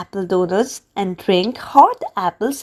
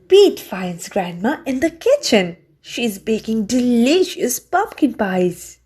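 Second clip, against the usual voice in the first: ordinary delivery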